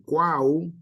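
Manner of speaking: "cuau" drawn out slowly as one syllable; sílaba completa. Shown as a demonstration. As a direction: stretch "cuau" with all three vowels together. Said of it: "ponemos toda la primera sílaba". ("cuau" lento, en una sola sílaba)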